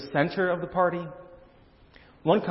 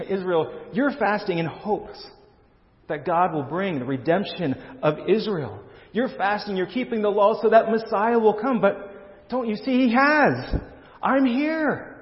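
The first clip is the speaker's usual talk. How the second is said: higher, insistent preacher's voice for Jesus, stretched on key phrases; Jesus answering Israel; 7 semitones higher than usual. Acted. Preacher voicing Jesus' answer on fasting to Israel, higher and insistent. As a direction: plead the case, pitch climbing higher as it builds. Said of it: "So here's Jesus' longer answer".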